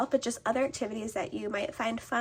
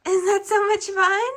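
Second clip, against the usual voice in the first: excited; 11 semitones higher, 9 dB louder than usual. Real, not acted. Mom cheering toddler at play.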